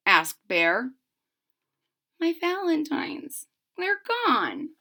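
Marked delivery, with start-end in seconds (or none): 2.2-4.7 a touch of distress, high and soft; mild cry. Acted. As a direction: sound lightly upset at the loss. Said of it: "cried Franklin".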